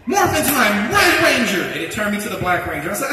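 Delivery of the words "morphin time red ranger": forceful high battle cry; heroic shout. Acted. Dreamer retrying his Red Ranger transformation, full heroic shout. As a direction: belt it out like a superhero transforming.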